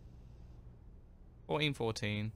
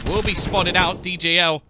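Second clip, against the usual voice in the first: louder, higher rising commentary; excited play-by-play; 4 semitones higher, 6 dB louder than usual. Acted. Caster calling the comeback win, excited and loud. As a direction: call the round's finish, building hype. Live casting of the kills.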